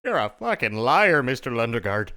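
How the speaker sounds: put-on loud Minnesota accent. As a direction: bark it like the Fargo line. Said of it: "you gotta get the true quote".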